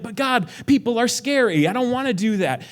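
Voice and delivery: high, loud whining objection; whiny complaint. Retold or imitated. Believer objecting to God's call, whining high and loud. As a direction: whine like a kid dodging a chore.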